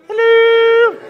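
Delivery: very high loud sing-song hello; high hello for Mary. Shown as a demonstration. Mary prompt during story.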